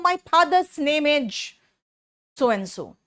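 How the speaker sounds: slow Hindi-accented "My father's name is"; wrong pronunciation demo. Shown as a demonstration. Teacher demonstrating the wrong way to students, slow and drawn out. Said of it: "आपको अच्छा नहीं लगेगा सुन के".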